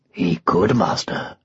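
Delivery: very low, husky lion; lion's voice. Acted. Lion assures his master about the unicorn, low and husky. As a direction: speak low as a lion confiding.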